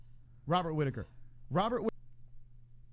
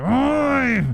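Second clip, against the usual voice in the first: pirate yell; 8 semitones higher, 6 dB louder than usual. Retold or imitated. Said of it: "You sound like a pirate there".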